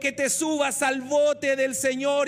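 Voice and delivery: pastor urging the church to step into God's boat; preaching appeal. Acted.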